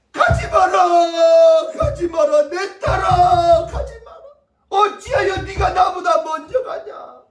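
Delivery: high, drawn-out grieving wail, loud at the start; acted wailing. Bereaved father clinging to his daughter's coffin, wailing. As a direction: cry out in full-voiced anguish, stretching the endings. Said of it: "울부짖어".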